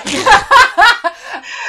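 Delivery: high, loud burst of laughter; real high-pitched cackle. Real, not acted. Laughs at own destroyed fabric.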